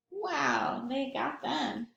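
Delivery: ordinary delivery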